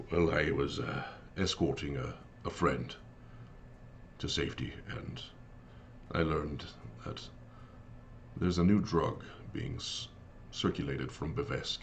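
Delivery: low, subdued, halting in-character reply; lightly voiced Tyron. Tyron explains his errand to the tall elf, lightly voiced and subdued.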